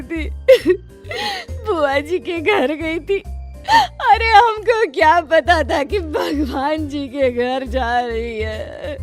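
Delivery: high, drawn-out theatrical sobbing; mourning wail. Acted. शोक सभा में बहू का विलाप. (बिलख-बिलख कर रोते हुए)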